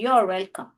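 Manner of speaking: "You are welcome" run together as "yor welcome"; contracted form. Shown as a demonstration. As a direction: squeeze "You are" into one quick "yor". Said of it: "ये आर को पूरा बोलने के बदले".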